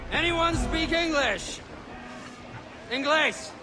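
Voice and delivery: high, drawn-out call to the crowd. Acted. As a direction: call across the deck for a translator. Captain calls out to captives.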